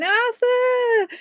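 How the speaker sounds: very high, drawn-out call of the name; mock calling out. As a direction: sing out the name on one high, held note. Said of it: "I'm sat there going".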